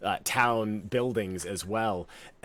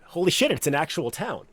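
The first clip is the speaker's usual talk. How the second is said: quoting Edgar; 7 semitones higher, 4 dB louder, faster than usual. Retold or imitated. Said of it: "as Edgar so eloquently put it".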